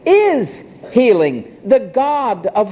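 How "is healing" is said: "Is" punched, "healing" slow; "Is" stressed hard, the words unhurried. Shown as a demonstration. Preacher stressing the Hebrew, "Is" emphatic and slow. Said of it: "The Hebrew is emphatic".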